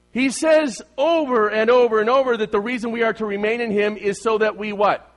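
loud, high, insistent preacher; ringing pulpit voice, pressing toward a question; full-force preaching. Acted. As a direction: drive the point home to the congregation.

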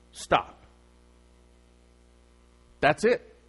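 ordinary talk, nothing marked

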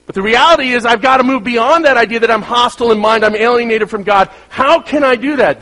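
high, loud preaching build; fervent preaching. Acted. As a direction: preach it with rising fervour. Preaching at full force.

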